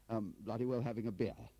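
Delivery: put-on high, stammering, bumbling old chap; flustered Crabbe figure. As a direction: hesitate and fumble through the news. Flustered Crabbe reports a sighting.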